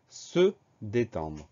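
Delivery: "se" set apart from "détendre"; teacher spacing out pronoun and verb; slow, separated words. Shown as a demonstration. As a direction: slowly say "se", pause, then "détendre". Shows pronoun before the verb.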